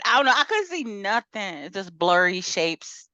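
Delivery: usual speaking voice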